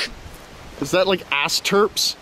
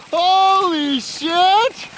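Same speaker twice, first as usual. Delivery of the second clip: slow, high, stretched holy shit; reacting to the crash replay, slow stretched amazement; drawn-out amazement; 9 semitones higher, much slower than usual. Real, not acted. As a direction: savor the disbelief.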